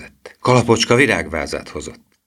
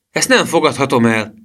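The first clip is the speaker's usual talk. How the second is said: mildly exclaimed, pitched up; exclaiming; 7 semitones higher, 4 dB louder than usual. A narrator's reading. Boy refusing money from his grandmother, mildly crying out. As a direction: protest with a small high cry. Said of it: "kiáltotta Emil".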